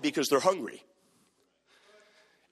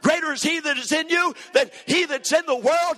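full-force preaching; 6 semitones higher than usual. Acted. Preacher urging the congregation to rise up and serve God, impassioned preaching.

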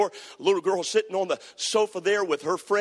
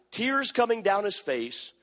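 normal talking voice

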